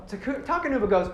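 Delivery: ordinary talk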